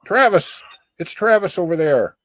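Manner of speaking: high, loud hail falling away; calling out. Real, not acted. Hailing Travis over there, calling out. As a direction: call the name to get attention.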